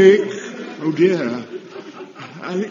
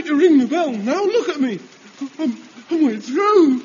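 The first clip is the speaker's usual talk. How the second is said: whining; 9 semitones higher, 3 dB louder than usual. Acted. Soaked boy complains, cry heard.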